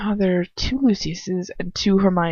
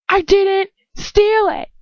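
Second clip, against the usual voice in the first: much higher, slow screamed denial; 13 semitones higher, 4 dB louder, slower than usual. Acted. Accused girl screaming her innocence at him. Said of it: "I scream".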